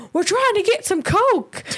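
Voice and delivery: put-on high, drawn-out kid calling out; imitating a little brother's pal calling out; voice from the background. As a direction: pipe up from the back, high and slow. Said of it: "from the background, you hear".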